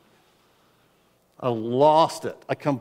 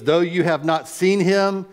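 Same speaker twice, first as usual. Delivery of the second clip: preacher reading scripture with fervor; reading scripture aloud; 4 semitones higher, 3 dB louder than usual. Acted. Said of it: "He says".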